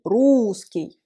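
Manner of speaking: whispered Русский with the stress on the ou. Shown as a demonstration. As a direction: breathe Русский, leaning on the first syllable ou. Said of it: "L'accent tonique est sur le ou".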